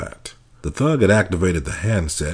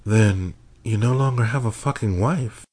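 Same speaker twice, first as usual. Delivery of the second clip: cold threat; 6 semitones higher than usual. A narrator's reading. Kidnapper threatens husband.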